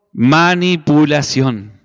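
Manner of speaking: manipulación drawn out slowly, every syllable weighted; slow stressed word. Shown as a demonstration. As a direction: spell out manipulación slowly for the congregation to repeat. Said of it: "diga conmigo". (manipulación, lento y marcado para que lo repitan)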